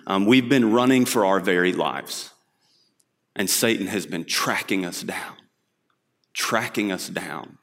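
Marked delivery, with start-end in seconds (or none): 3.3-7.6 softer and softer, slowing down; fading repetition. Acted. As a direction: let the words sink away. Repeating, fading for effect.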